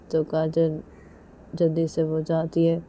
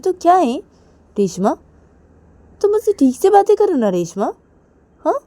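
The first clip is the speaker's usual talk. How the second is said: put-on higher, louder, fast girl voice; higher loud teasing insistence; insistent Kajal; 10 semitones higher, 8 dB louder than usual. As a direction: push her, half teasing.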